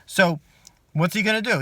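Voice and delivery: ordinary talk, nothing marked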